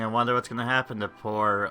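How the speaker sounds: ordinary delivery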